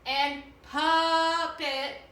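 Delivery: "puppet" sung slowly, with the short U in the first syllable drawn out; sounding out the word. Shown as a demonstration. Teacher to students at home, slowly singing out the word puppet. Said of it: "the U says pu".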